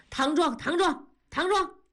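high-pitched, defiant repeats; insistent rapid repetition. A narrator's reading. Husband refuses to back down to his wife, defiantly insistent. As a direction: fire it back again and again, pitch climbing. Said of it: "连声地说".

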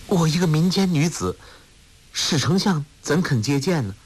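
high-pitched, worried female character voice; humble girl questioning, pitched well up; doubtful young woman. A narrator's reading. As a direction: protest in a high, incredulous voice. Woman doubts minister will see her.

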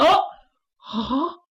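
a short crying wail; wife's tearful cry. Acted. Wife facing her husband's sword cries out in tears. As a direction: let out a frightened sob.

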